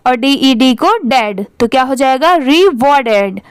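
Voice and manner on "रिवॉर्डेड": slow रिवॉर्डेड, split "ree... war-ded"; syllable-by-syllable model. Shown as a demonstration. Teacher models it for pupils to repeat, slow and split. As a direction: say रिवॉर्डेड slowly with a break after REE. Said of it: "आप भी मेरे बात pronounce करिए".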